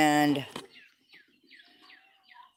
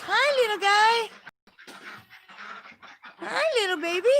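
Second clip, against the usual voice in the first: higher, sweet cooing voice; 6 semitones higher than usual. Real, not acted. Cooing at newborn kittens.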